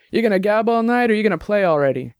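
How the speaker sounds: half-shouted prod to the band; mild shout. Acted. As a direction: chide them across the room, without real volume. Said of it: "J T shouted from behind the bar".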